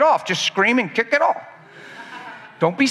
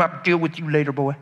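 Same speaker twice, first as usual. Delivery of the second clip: dropped, quieter menacing dad; low parental threat; 5 semitones lower, 4 dB quieter than usual. Acted. Father threatening his boy, low and quiet. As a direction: growl the promise low.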